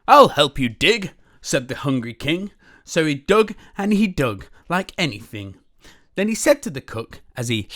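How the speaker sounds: lively, loud read-aloud storytelling; animated picture-book reading; story narration. A narrator's reading. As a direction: read it big and bouncy for kids.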